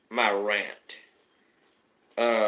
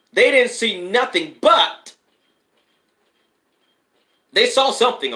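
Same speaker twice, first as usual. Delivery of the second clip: rising excitement; 7 semitones higher, 5 dB louder than usual. Real, not acted. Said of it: "I feel it already".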